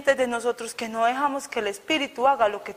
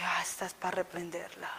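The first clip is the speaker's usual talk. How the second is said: softer, unvoiced whisper to herself; 4 semitones lower, 12 dB quieter than usual. Thinking to herself before rebuking the woman, a hushed whisper. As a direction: breathe it like a private decision.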